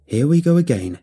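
put-on loud weary scoff at old troubles; exasperated cry. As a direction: throw it out, fed up and loud. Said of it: "cried Doctor Whittle".

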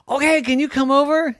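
high, quick eager question; excited invitation. Acted. Evan inviting mouse over, excited.

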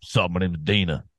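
mock-cool, low butch greeting; mock butch woman. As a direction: drop the voice for a hammed-up butch introduction. Plays the butch woman just described.

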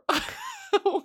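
high squealing laugh; a giddy high-pitched laugh; high laugh burst. Real, not acted. Laughing at inside joke.

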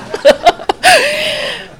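a loud, high cackle of real laughter; high burst of laughter. Real, not acted. After the crying-woman punchline, a loud, high real laugh. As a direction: let a big laugh break out at the irony.

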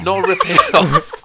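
laughing denial. Real, not acted.

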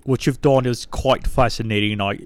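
mock a judge who takes it too seriously; posh talent show judge.

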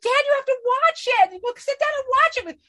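put-on very high, loud pleading child voice; little kid. Kid begs dad to watch the show, pleading child voice. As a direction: imitate a kid nagging a parent. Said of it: "when I was a little kid".

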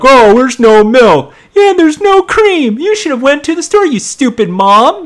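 very high, loud, squeaky mock dialogue; mocking bratty voices. Retelling the mom and daughter over missing milk, mocking squeaky voices. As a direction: ham up the breakfast squabble. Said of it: "The mom's like".

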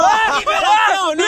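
high, slow shouted cheer; shouting cheer. Real, not acted. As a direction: shout it out in triumph. Cheering a mock revelation.

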